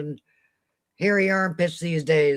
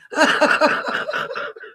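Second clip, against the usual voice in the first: high, breathless laughing fit; real laugh that runs out of air; helpless laughter; 10 semitones higher than usual. Real, not acted. Cracking up at a friend's bikini joke, helpless laughter.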